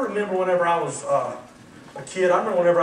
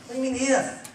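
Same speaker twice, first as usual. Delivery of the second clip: usual speaking voice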